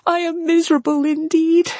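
high-pitched cry of misery; anguished. A narrator's reading. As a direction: wail it high, near to sobs. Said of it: "in the anguish of her heart".

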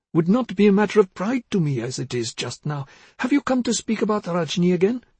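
put-on high-pitched, rambling elderly-mother character; confused mother. A narrator's reading.

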